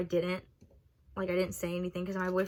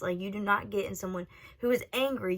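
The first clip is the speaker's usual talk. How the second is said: ordinary talk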